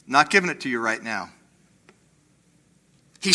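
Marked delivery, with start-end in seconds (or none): 0.0-1.3 offhand brush-off, quick, then dropping lower and softer; dismissive shrug. Retold or imitated. Preacher voicing God putting off the blessing, mock dismissive. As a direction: toss it away like it's nothing. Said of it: "God seems to say".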